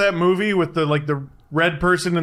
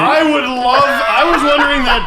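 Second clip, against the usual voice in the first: excited laughing shout; 8 semitones higher, 4 dB louder than usual. Real, not acted. Excited about jersey.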